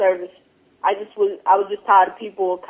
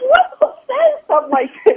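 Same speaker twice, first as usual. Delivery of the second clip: high, incredulous what; baffled outrage at a rude remark; appalled at the pastor's rude remark, disbelieving; shocked disbelief; 3 dB louder than usual. Retold or imitated. Retelling a rude eulogy remark.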